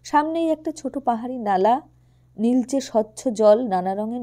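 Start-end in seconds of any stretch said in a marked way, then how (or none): none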